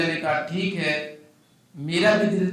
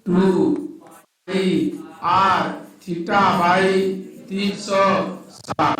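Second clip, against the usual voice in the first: slow dictation; much slower than usual. Shown as a demonstration. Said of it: "तो आप यहाँ पे कह सकते हैं क्या?".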